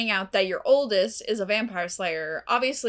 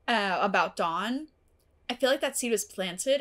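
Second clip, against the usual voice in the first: ordinary talk, nothing marked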